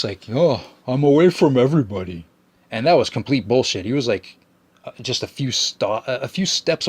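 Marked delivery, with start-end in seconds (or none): 0.3-2.3 a mock whiny voice for the old smoker, louder. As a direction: lightly send up his excuse.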